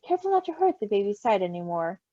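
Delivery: ordinary delivery, nothing marked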